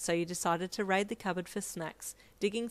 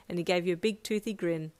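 usual speaking voice

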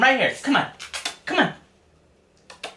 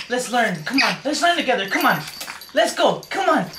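ordinary delivery

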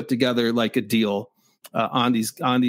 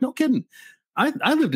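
surprised; 12 semitones higher than usual. Retold or imitated. Retold reaction, same Chicago past.

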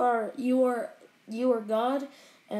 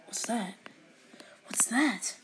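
whispering; 9 dB quieter than usual. Real, not acted. Whispering at a secret.

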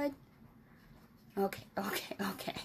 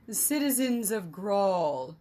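slow, louder proclamation voice; emissary's address; 5 dB louder than usual. Acted. Imperial emissary calling out to the crowd, grand address. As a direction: announce it grandly from the balcony.

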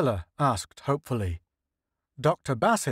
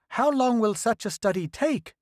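lightly raised voice of an eager official; a hint of a higher, hopeful leader; Senate leader voice; 10 semitones higher than usual. Senate leader asks scientists about the alien ship, lightly raised voice.

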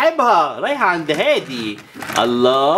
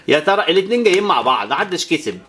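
ordinary delivery

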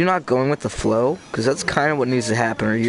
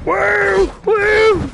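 very high loud woo; cheering whoop; 4 dB louder than usual. Real, not acted. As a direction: whoop in triumph. Cheering in game.

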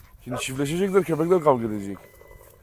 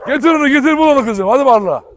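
excited urging; 10 semitones higher, 8 dB louder than usual. Real, not acted. Urging dog to find ball.

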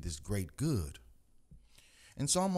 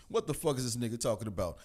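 normal talking voice

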